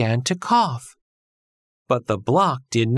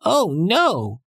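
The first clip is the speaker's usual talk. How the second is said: scream; 6 semitones higher than usual. A narrator's reading. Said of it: "screamed Billy".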